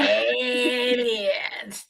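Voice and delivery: long, stretched-out singsong; playful echo. Pretend. Host echoing her co-host's topic, playfully drawn out. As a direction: hold the first vowel in mock awe.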